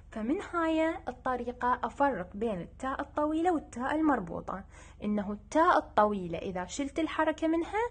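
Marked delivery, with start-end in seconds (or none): none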